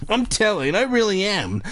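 high little-sister tattling voice; whiny kid's declaration; declaring. A narrator's reading. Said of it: "Ashley declared".

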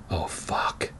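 hushed, voiceless aside; whispered dread. Acted. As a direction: whisper it under the breath in mock dread. Reaction to the evil smile.

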